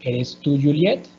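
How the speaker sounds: "¿Eres tú Juliet?" climbing on "Juliet"; Spanish yes-no question with lifted "Juliet"; rising intonation. Shown as a demonstration. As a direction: swing "Juliet" up at the end.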